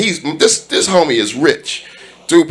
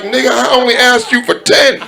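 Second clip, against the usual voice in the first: exasperated; 10 semitones higher than usual. Retold or imitated. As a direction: throw your hands up in disbelief. Exasperated retelling of friend.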